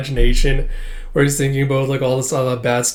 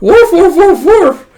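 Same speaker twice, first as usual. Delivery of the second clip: very high, loud eager barking; dog barks; 11 dB louder, slower than usual. Acted. Bond agreeing to peace, high loud barking. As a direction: bark happily in a row. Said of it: "And he's like".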